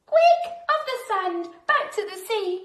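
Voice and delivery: high, loud panicked cry; urgent cry. A narrator's reading. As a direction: shout to get the whale moving. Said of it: "cried the snail".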